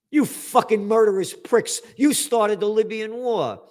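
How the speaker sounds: furious rant at hypocrites; angry tirade. Acted. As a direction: lash out at them. Ranting at the French.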